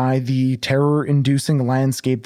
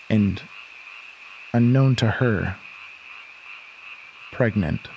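story narration; 2 semitones lower, 3 dB quieter than usual. A narrator's reading. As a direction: read it like a dark tale unfolding.

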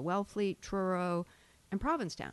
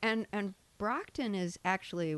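ordinary delivery

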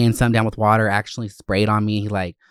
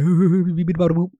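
higher, babbling imitation of tittering gossips; mock giggle; 7 semitones higher, 4 dB louder than usual. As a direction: fake a silly chattering laugh. Imitates staff tittering together.

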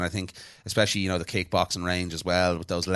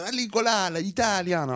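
high, hammed-up mock Italian gibberish; 12 semitones higher than usual. Mocking the Italian man on the plane, high fake Italian. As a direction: mimic him babbling Italian. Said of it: "And he was like".

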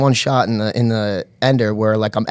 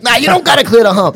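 high mock retort; arguing park guy; 11 semitones higher, 4 dB louder than usual. Other guy at the jump disagrees, arguing back. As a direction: imitate the buddy firing back, pitched way up. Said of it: "these guys are arguing".

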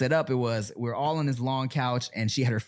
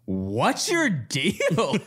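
drawn-out, raised mock challenge; mock confrontational retort; 4 semitones higher, much slower than usual. Storyteller retells her retort to the girl blocking her, mock confrontational. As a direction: stretch it slowly, half laughing.

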